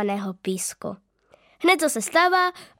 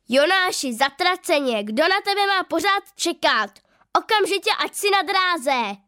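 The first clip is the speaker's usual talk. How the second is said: an angry coach yelling across the field, pitched up; booming coach; 6 semitones higher than usual. A narrator's reading. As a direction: bellow at the boy to get back on the track. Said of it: "Burácí venku hlas trenéra".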